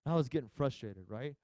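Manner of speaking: ordinary talk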